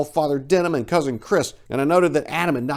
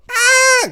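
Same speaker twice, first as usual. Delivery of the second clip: very high, loud shriek of alarm; shrill shriek; 9 dB louder than usual. Acted. Woman shrieking before running from the fire, shrill scream. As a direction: let out a piercing scream. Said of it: "came her response".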